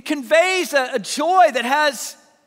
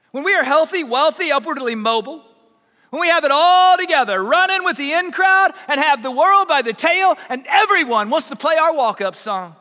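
impassioned preaching; 3 semitones higher, 6 dB louder than usual. Real, not acted. Preacher listing worldly success to the congregation, impassioned. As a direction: ring it out, stretching the key words.

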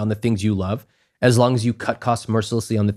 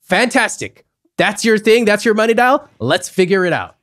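a thrilled cheer, higher and louder; gushing approval, pitched way up; excited; 9 semitones higher, 5 dB louder than usual. Retold or imitated. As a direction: cheer her on like her passion is great. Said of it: "you saw my reaction".

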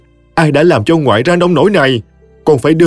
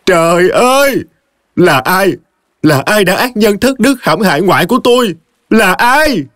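anguished cry; 9 semitones higher than usual. Acted. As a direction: cry out to the heavens in grief. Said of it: "Cô la hét đòi tìm bà". (tiếng kêu gào cao vút, đau đớn)

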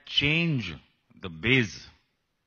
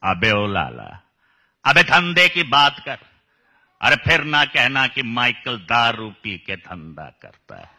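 loud gruff villain voice; Pran impression; 7 dB louder than usual. Shown as a demonstration. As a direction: bark like an old screen villain. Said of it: "Like Pran also had".

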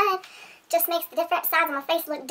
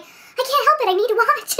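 higher, mock-helpless protest; pleading self-defence; 7 semitones higher, 4 dB louder than usual. Wife answering her husband about crying at posts, mock-helpless plea. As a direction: plead your case with a laugh breaking in. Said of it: "I'm like".

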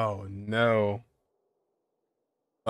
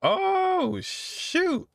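high, sudden oh shoot; squeaky startled exclamation. Real, not acted. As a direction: caught off guard by the twist. Sudden twist in cutscene.

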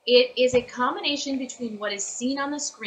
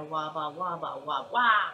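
Wobbuffet syllables; 4 semitones lower, slower than usual. Shown as a demonstration. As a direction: chant the syllables to match the flap. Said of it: "I'll go".

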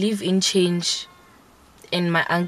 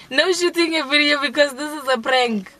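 prank reveal laughing; 7 semitones higher than usual. Acted. Repeats line, laughing, prank reveal.

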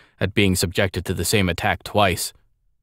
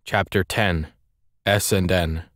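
usual speaking voice

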